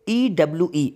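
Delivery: "ई डब्ल्यू ई" as separate letter names; spelled out. Shown as a demonstration. Teacher withholds the word's pronunciation, spelling "ई डब्ल्यू ई" by letters. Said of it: "मैं इसको spelling पढ़ रहा हूँ".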